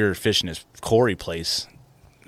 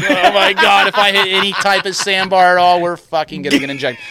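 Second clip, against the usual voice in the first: loud, higher, laughing alarm; half-laughing terror as the boat bounces; laughing panic; 10 semitones higher, 8 dB louder than usual. Retold or imitated. Bouncing over shallow water, laughing panicked alarm. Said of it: "You're like".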